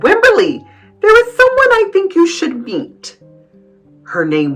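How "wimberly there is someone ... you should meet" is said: high, louder teacher voice; cheery teacher. A narrator's reading. Teacher introducing Wimberly to someone, high and cheery. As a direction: call her over in a high, inviting voice. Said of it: "Then Missus Peachum said".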